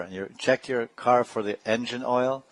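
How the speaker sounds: usual speaking voice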